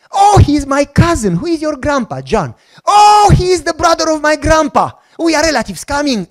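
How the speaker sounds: high, loud delighted outburst, acted; excited discovery. Director discovering the visitor is her cousin's son, excited. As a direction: exclaim it like finding long-lost family.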